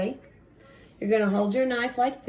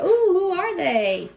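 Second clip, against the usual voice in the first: surprised; 9 semitones higher, 6 dB louder, slower than usual. Acted. Mom reacts to special guests.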